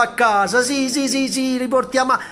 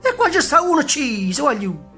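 alarmed shout; 8 semitones higher, 4 dB louder than usual. Acted. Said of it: "gridò uno".

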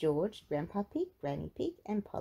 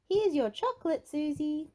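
Peppa voice; 8 semitones higher, 4 dB louder than usual. Acted.

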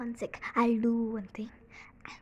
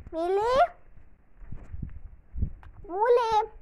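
high, loud call with a rise; high shouted call for the dog; 8 semitones higher, 8 dB louder than usual. Real, not acted. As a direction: call the dog by her nickname. Calling the dog.

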